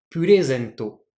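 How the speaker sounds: purezento with pitch up on re, dropping before zen; pitch accent demo. Shown as a demonstration. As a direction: lift re in purezento, then drop. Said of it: "je monte avant le ré et je descends avant le zé".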